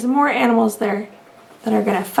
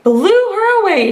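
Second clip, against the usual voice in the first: elephant shout; 5 semitones higher, slower than usual. A narrator's reading. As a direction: trumpet the boast. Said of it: "shouted the elephant".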